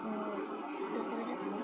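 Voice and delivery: too quiet, mic barely picks it up; barely audible. Real, not acted. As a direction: speak very softly without meaning to. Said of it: "suaranya terlalu kecil".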